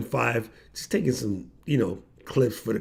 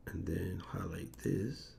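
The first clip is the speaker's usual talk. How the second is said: whisper; 7 semitones lower, 18 dB quieter than usual. Real, not acted. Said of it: "aside while operating software".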